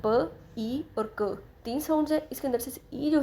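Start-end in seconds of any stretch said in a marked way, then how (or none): 0.1-1.4 slow, isolated sounds "प", "ई", "क" with gaps between; teacher breaking a word into "प, ई और क"; separate sounds. Shown as a demonstration. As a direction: sound out "प, ई और क" one by one, slowly. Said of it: "इसके अंदर हमें तीन साउंड्स हैं".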